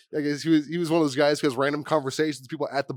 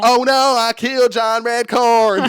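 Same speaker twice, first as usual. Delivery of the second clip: loud, high, slow panicky cartoon voice; hammed-up high panic, loud and drawn out; mock Dale voice; 8 semitones higher, 9 dB louder, slower than usual. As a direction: yelp it out like a nervous neighbor. Dale voiced after bonking Redcorn.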